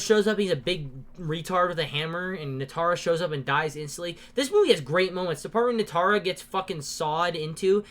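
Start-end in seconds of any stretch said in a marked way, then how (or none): none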